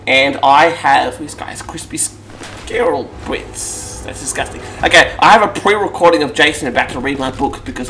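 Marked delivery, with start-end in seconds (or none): 1.2-4.6 slow unvoiced whisper. Real, not acted. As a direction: whisper it aside. Whispered aside.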